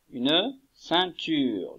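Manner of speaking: Une ceinture drawn out slowly, syllable by syllable; teacher spelling out Une ceinture at a very slow pace; slow careful naming. Shown as a demonstration. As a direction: say Une ceinture slowly so children hear each sound. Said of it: "teacher models the word slowly".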